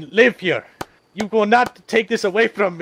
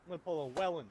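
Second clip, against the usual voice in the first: much softer, muttered to himself; quiet repeat; 4 semitones lower, 17 dB quieter, much faster than usual. Real, not acted. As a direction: mutter the line under your breath.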